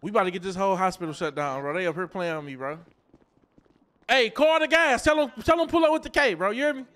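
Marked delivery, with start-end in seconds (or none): 4.1-6.9 loud, high mock threat to call the crew; hammed-up loud threat. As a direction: shout it like you're calling backup.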